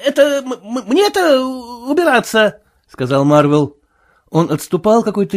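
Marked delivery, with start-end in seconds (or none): 0.0-2.6 high-pitched, stuttering protest; stammering indignation. A narrator's reading. Marvel answering the sailor's threat, stammering and indignant. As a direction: stumble over it in an offended squeak.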